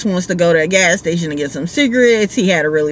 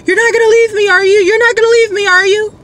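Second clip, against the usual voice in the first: high, louder, pleading panic; freaking out; 5 dB louder, faster than usual. Retold or imitated. Drunk rider begs the driver not to leave, panicked and high. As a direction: imitate a scared drunk begging not to be left. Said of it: "He starts freaking out".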